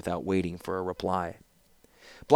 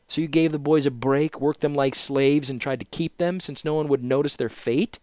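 scolding; 7 semitones higher than usual. Acted. Scholar scolds the wagon master.

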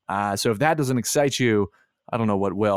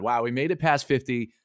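usual speaking voice

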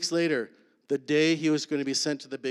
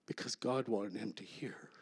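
whisper; 3 semitones lower, 11 dB quieter than usual. Real, not acted. Hushed point of the story.

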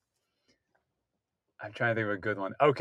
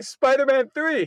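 stunned recognition; 9 semitones higher, 4 dB louder, slower than usual. Retold or imitated. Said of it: "I look back, I was like".

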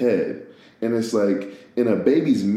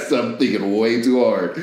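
words pushed out through laughter; laughing. Real, not acted. Speaker admitting they overthought it, laughing. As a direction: laugh at yourself while talking. Cracks up at own spins.

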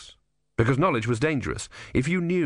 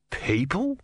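hesitant question; 7 semitones higher than usual. Acted. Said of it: "it said eventually".

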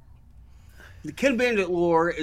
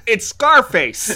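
slow hammy delivery; 5 semitones higher, 8 dB louder, much slower than usual. Retold or imitated. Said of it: "was like".

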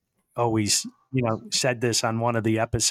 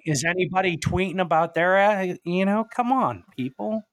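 exasperated; 6 semitones higher than usual. Real, not acted. Exasperated rant about critics.

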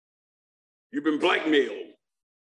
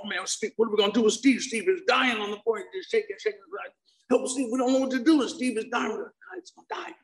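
high, fast panicked babble; frantic teens freaking out over Steve; mock panic; 8 semitones higher than usual. Narrator reliving friends panicking over Steve, high frantic.

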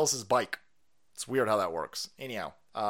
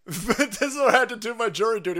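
laughing through the line, a bit louder; 8 semitones higher, 5 dB louder than usual. Real, not acted. Mocking Oakland courts, laughing at his own jury duty. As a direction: crack up while repeating it.

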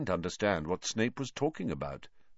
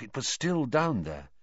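ordinary delivery, nothing marked

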